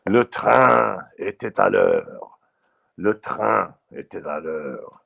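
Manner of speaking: slow, deliberate French pronunciation; retry the French line, drawn out; careful French accent. Shown as a demonstration. Retrying French for the listeners, careful French accent. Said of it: "Let me try that again".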